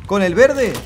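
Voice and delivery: ordinary talk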